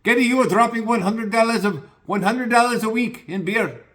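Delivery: put-on high, loud Indian shopkeeper accent. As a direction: play the Indian store owner teasing him in an Indian accent. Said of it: "the little Indian guy that runs the place goes".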